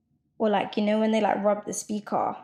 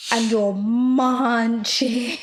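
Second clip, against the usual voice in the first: MUNCHING stretched right out; very slow, emphatic munching; exasperated, drawn out; 3 dB louder, much slower than usual. Real, not acted. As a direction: drag out the word munching in complaint. Complaining about loud chewing on phone.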